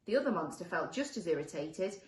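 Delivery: ordinary delivery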